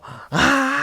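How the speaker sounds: loud high-pitched scream of terror. Retold or imitated. Storyteller waking from the nun nightmare, screaming. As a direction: scream out long and high, acting it out. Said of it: "I scream, I go".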